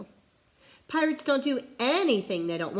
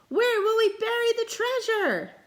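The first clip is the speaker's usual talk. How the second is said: high, loud dismayed holler; 5 dB louder than usual. Acted. Captain worries for treasure, loud holler. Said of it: "hollered Braidbeard".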